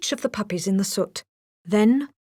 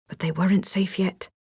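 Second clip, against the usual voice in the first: ordinary delivery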